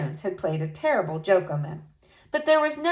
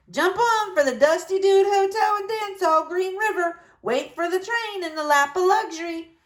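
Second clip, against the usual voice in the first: calling out; 10 semitones higher than usual. A narrator's reading. Second sleigh driver calling out.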